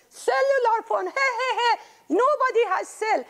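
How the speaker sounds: put-on high giggling kid voice; laughing child. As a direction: giggle through the words.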